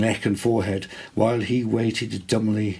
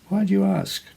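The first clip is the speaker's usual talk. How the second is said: lady's voice; 11 semitones higher, 3 dB louder than usual. A narrator's reading. Lady asks Theodoric.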